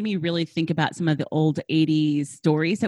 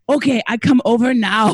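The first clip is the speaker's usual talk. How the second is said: loud, higher imitated Korean accent; mother's Korean accent; 5 semitones higher, 8 dB louder than usual. Mother testing her child by phone, imitated Korean accent. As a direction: announce it in Mom's Korean accent. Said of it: "And she's like".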